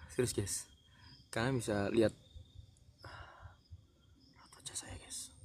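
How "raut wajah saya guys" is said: whispering. Real, not acted. Scared vlogger at haunted spot.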